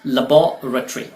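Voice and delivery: लेबोरेटरी as "luh-BAW-ruh-tree"; British लेबोरेटरी with "BAW" stressed; UK pronunciation. Shown as a demonstration. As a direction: lean on "BAW" in लेबोरेटरी, squeeze the ending to "tree". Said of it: "UK pronunciation के लिहाज से".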